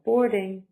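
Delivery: teacher models boarding, first beat stressed; stress on first syllable. Shown as a demonstration. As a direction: hit board, ease off on ing. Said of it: "Stress is on the first".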